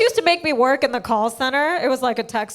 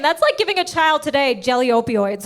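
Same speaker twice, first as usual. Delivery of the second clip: ordinary delivery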